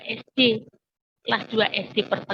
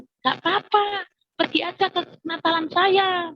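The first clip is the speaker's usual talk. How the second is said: put-on child friend; 8 semitones higher than usual. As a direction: imitate a child coaxing a shy friend. Child friend reassures and invites her.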